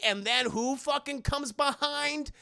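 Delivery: normal talking voice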